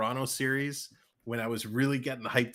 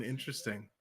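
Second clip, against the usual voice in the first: ordinary talk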